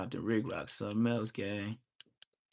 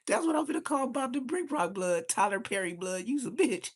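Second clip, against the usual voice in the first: 1 laughing taunt; 12 semitones higher than usual. Real, not acted. Laughing mockery of rival.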